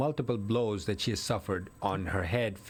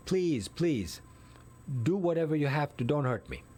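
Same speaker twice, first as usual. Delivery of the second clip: higher-pitched, understated imitation of pleading; retold screamed plea; 5 semitones higher than usual. Wife begging her attacker from the basement, mildly acted plea. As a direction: retell a victim's desperate begging without raising the volume. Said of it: "screaming and saying".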